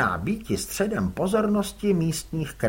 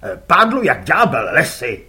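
louder gruff curt reply; gruff father; 10 dB louder than usual. Acted. Father answers his son curtly, gruff.

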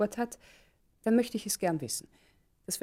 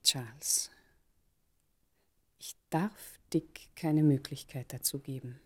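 slow, voiceless whisper; barely audible, slow whispered vow; 4 semitones lower, 5 dB quieter, slower than usual. Acted. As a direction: let it slip out as a hushed resolve. Intimate confession, slow whisper.

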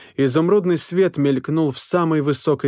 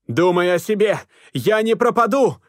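shouting; 6 semitones higher than usual. A narrator's reading. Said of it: "прокричал друг".

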